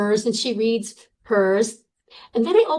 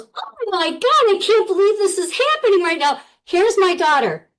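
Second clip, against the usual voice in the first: much higher, louder gushing; mom overjoyed; 9 semitones higher, 4 dB louder than usual. Retold or imitated. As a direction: ecstatic mother at the news. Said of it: "my mom goes".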